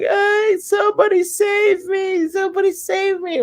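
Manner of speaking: a sarcastic high-pitched wail, as if crying to a mob; mock crying plea. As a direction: ham up a helpless cry to the crowd. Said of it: "starts crying to the mob".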